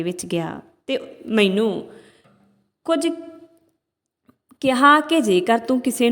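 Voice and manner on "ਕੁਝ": ordinary delivery, nothing marked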